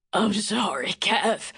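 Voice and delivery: hoarse croak. Acted. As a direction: croak it out weakly. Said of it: "he croaked".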